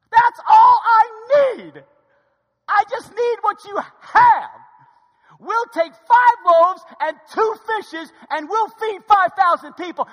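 high, very loud, slow shout; booming declaration as God. Acted. God answering disciples that loaves suffice, booming shout. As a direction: stretch each phrase out at full power. Voices God's triumphant answer.

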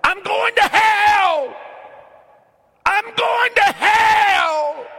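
very high, very loud drawn-out scream; full-force scream. Retold or imitated. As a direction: scream it at the top of your lungs. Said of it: "screamed to the top of his lungs".

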